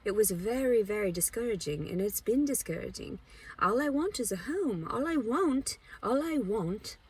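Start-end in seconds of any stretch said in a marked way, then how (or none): 3.6-7.0 pleading. Real, not acted. Telling viewers about homelessness, a high aching plea fading away. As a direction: repeat it like a plea that gives out.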